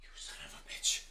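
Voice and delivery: breathless whispered swear under strain; whispered curse. Real, not acted. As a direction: hiss it out mid push-up. Straining through push-ups.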